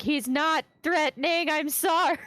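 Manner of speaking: high, slow, drawn-out yell; yelled apology. Acted. Companion excusing a friend to people with drawn weapons, yelled. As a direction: yell it over to calm armed strangers. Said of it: "I'm gonna yell and just be like".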